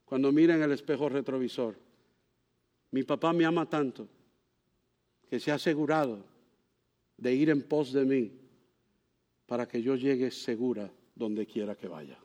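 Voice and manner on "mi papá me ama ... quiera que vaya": softer and lower, tender thought; tender daughter. Pretend. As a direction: let her voice fall soft with love. La hija piensa con ternura. (pensamiento tierno de la hija, más suave y grave)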